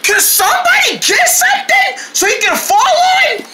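high-pitched alarmed yell; urgent plea. Acted. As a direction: call out for help in a hurry. Urgent plea to catch the jumper.

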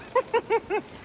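high taunting cackle at his captives; mocking laugh. Mutineer gloats over his bound rivals with a cackle. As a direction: cackle in their faces. Pirate mocks captured rivals.

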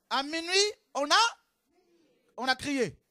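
high, loud call of à minuit; proclaimed midnight cry. Acted. (proclamation forte de minuit)

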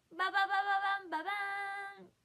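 loud drumroll reveal noise; loud fanfare. Real, not acted. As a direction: trumpet the reveal. 商品を見せる効果音. (大きくファンファーレ)

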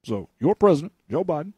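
mock presidential address; Obama. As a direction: break the speech into measured phrases with pauses. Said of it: "this is Obama".

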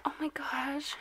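hushed awed whisper. Real, not acted. Owner whispers in awe at the chinchilla. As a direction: breathe it out in awe.

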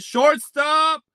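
high, loud shout of hope; sudden hyped-up yell; excited outburst. Real, not acted. Calls for the wanted card.

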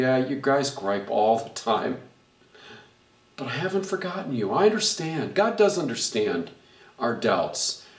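ordinary delivery

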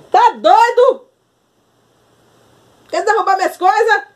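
high, loud startled scolding. Real, not acted. Scolding the cat that's knocking her things off.

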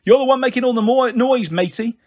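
high, fast retort; indignant yell. A narrator's reading. Mum answering the rude neighbour, indignant yell. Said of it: "Mum yelled".

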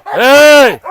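loud, high warning yell; alarmed shout. Real, not acted. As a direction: stop them now. Dogs clash again.

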